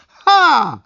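a very high, loud shrieking Ha; shrieked Ha. Acted. Lidia catching him red-handed, a high loud shriek. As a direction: shriek it out like a furious woman. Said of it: "wykrzyknęła".

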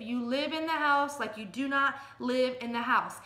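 ordinary talk, nothing marked

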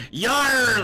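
high, hammed-up pirate growl-cry; acted pirate yell. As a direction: let out a mock pirate "yar". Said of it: "he yelled out".

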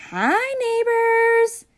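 high, slow cartoon fish. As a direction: squeak slowly like a fish. Fish's voice.

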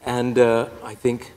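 ordinary talk

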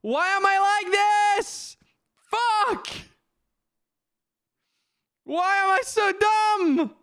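very high, drawn-out cry of self-reproach; high anguished whine; exasperated wail. Real, not acted. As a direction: wail at yourself in a high pitch. Berating self over blunder.

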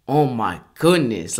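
ordinary talk, nothing marked

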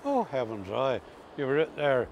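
ordinary talk

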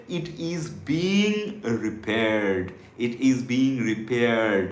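drawn-out, word-by-word model sentence; very slow drill. Shown as a demonstration. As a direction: stretch each word for learners to repeat. Model sentence for learners to repeat. (খুব ধীরে, টেনে টেনে বলা)

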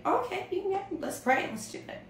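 mock-chirpy, high-pitched okay; lightly acted eager consent; agreeable reply. Acts out a normal agreeable answer.

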